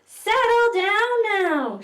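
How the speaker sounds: very high, loud, slow call to order; megaphone call. Acted. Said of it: "she says. She means business. She has a megaphone".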